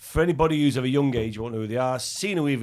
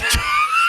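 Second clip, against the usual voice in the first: a loud, high burst of laughter; 9 dB louder than usual. Real, not acted. Laughing at his own joke about days off, loud high laugh. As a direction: crack up loudly.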